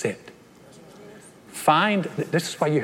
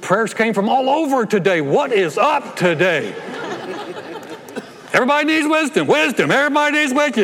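much higher, louder hammed-up exasperation; mock frazzled God; 9 semitones higher, 4 dB louder than usual. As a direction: play God buried in prayer requests. God voiced, overwhelmed.

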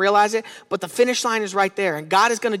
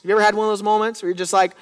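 normal talking voice

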